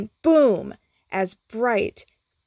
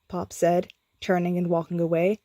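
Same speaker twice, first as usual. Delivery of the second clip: ordinary delivery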